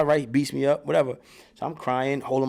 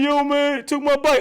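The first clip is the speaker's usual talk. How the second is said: put-on much higher, louder kid's complaint; little brother whining; 13 semitones higher, 6 dB louder, slower than usual. Little brother whining that his bike got taken, much higher. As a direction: whine it like a robbed little kid. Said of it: "He like".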